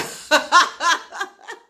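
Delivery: high loud cackle; burst of laughter. Real, not acted. Ex boyfriend admits he's scared, high loud laugh. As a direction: crack up at the absurdity.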